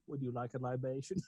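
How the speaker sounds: low, very soft offer; Herzog catchphrase. Pretend. Podcaster sneaking a running gag into a quote, quiet impression. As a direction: slip it in quietly, low and deadpan.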